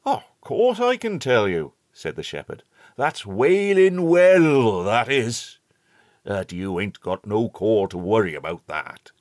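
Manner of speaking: put-on a hearty old countryman calling out; old rustic shepherd. A narrator's reading. Shepherd names Wailing Well.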